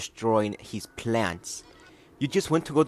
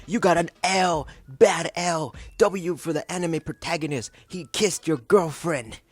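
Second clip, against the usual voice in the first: raised, higher taunting jeer; mock crowd yelling; 7 semitones higher than usual. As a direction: taunt like a schoolyard crowd cheering a win. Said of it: "yelling at the anime bully".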